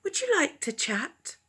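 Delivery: high, soft owl voice; shy little owl asking. Acted. Owl telling the cats his wish, high and soft. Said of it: "Owl said".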